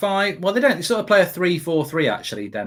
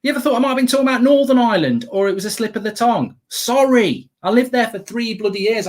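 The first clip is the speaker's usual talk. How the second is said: exasperated outburst; 7 semitones higher than usual. Real, not acted. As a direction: fire back at the commenters, fed up. Angry rant at online commenters.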